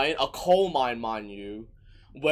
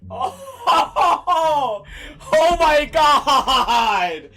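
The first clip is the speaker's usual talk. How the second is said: loud, high, slow laughing cry; stunned laughing groan; laughing disbelief; 11 semitones higher, 8 dB louder, much slower than usual. Real, not acted. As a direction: howl with laughter. Laughing outburst.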